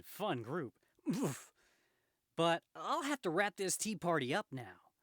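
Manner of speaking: lively, sing-song mock courtesy; wisecracking Spider-Man. Spider-Man taking leave of the crooks, mock-polite. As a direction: toy with the crooks, swooping up and down.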